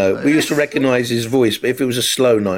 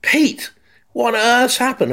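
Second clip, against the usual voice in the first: much higher, shouting down the line; much higher frantic call; panicked shout; 13 semitones higher, slower than usual. Retold or imitated. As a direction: yell for the producer in panic. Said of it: "I'm shouting down the line".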